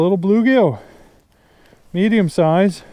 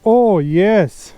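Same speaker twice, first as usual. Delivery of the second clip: laughing with delight at a big catch; thrilled, laughing, drawn-out exclamations; excited; 3 semitones higher, 4 dB louder, much slower than usual. Real, not acted. Big bluegill caught.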